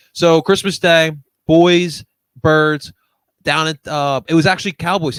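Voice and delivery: loud, hyped build-up; loud sports-fan hype; excited. Real, not acted. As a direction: pump up the story of the big win. Hyped storytelling of big game.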